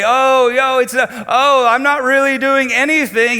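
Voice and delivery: a hint of a high, loud, innocent denial; mock innocent man. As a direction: pretend not to know what you're doing. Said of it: "a man is doing this so innocently".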